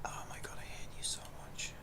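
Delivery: whispered, breathy despair at the screen; exasperated whisper. Real, not acted. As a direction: whisper it through clenched frustration. Exasperated at the AI assistant.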